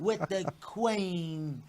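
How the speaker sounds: very slow, softened mock British; British accent. Shown as a demonstration. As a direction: draw every word out in mock British. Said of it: "do your best British accent".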